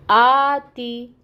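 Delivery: आती very slow, आ drawn long; long madd. Shown as a demonstration. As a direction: stretch the आ of आती. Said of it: "दो हरकत लंबा पढ़ते हैं".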